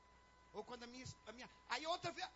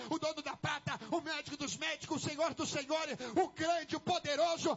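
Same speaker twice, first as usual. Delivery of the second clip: loud, driving sermon building to a shout; full-force preaching; 9 dB louder, slower than usual. Real, not acted. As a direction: build relentlessly to a shouted, stretched final promise.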